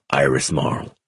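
low, soft, intimate name; tender. A narrator's reading. Man telling Iris he returned for her, tender.

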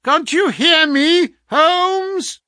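high, loud, slow call; insistent waking shout. A narrator's reading. Visitor rouses the sick man, shouting insistently. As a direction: demand a response from a sleeper. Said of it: "insistent tone of one who awakens a sleeper".

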